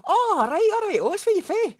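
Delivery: very high, loud Scottish hail; broad Scottish greeting. Retold or imitated. Meeting another Scot for the first time, a loud high broad Scottish greeting. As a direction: shout it out like meeting a fellow Scot. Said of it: "this is what happened next".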